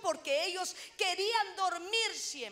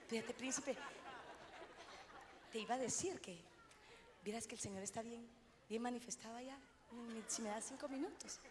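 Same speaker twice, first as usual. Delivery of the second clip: restrained hush; 3 semitones lower, 13 dB quieter, faster than usual. Retold or imitated. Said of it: "wife telling husband, now restrained".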